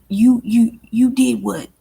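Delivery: ordinary talk, nothing marked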